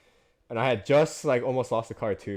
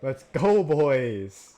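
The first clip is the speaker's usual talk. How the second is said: loud, raised victory yell; rousing cheer; 6 dB louder, much slower than usual. Real, not acted. Racer cheering to the boys, loud excited yell.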